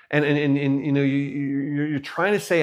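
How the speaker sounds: normal talking voice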